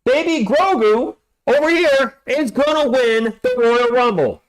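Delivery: slow, high Royal Rumble hype; squeaky hype voice. Acted. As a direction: hype it up in a tiny character voice. Baby Grogu hype, put-on voice.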